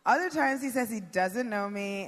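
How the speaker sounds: usual speaking voice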